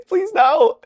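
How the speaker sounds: very high, loud squeal; panicked pleading. Retold or imitated. Prankster seeing her raise her hand, panicked pleading. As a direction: beg her in hammed-up panic. Dreading her telling the teacher.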